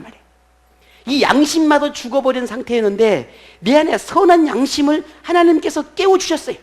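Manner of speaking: high, loud, ringing sermon delivery; full-force preaching. Real, not acted. Pastor proclaiming God awakened the conscience, full-force preaching. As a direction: drive each phrase home to the congregation.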